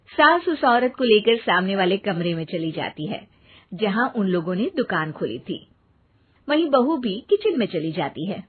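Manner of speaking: story narration. A narrator's reading. Narrator telling the audience what happens, in a calm storytelling voice. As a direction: narrate the scene calmly.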